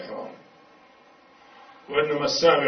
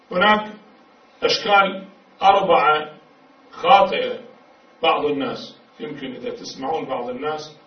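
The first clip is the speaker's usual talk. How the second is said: ordinary talk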